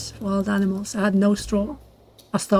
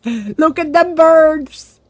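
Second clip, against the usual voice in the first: higher, louder and slow with amazement; excited; 8 semitones higher, 8 dB louder, much slower than usual. Real, not acted. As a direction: stretch it out in delight.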